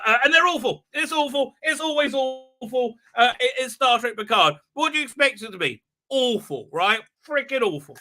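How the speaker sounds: high-pitched disgusted complaint; exasperated griping. Real, not acted. Complaining about the titles.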